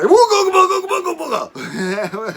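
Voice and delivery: hammed-up high whooping yell, very loud; a goofy jungle-creature holler; wild mock war cry. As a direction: bellow it like a mud monster jumping out of the bushes. Mud-covered, charging at friends.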